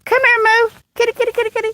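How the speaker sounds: high coaxing cat call. Real, not acted. Owner calling her cat Moo, high coaxing call. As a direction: coax the cat over, sing-song and high.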